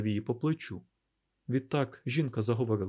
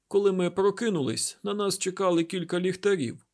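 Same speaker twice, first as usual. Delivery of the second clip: higher-pitched, slightly louder character voice; injured woman recounting events; 6 semitones higher, 4 dB louder than usual. A narrator's reading. Derkhan tells Isaac about waking up, higher-pitched recounting. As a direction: voice a woman urgently telling what happened.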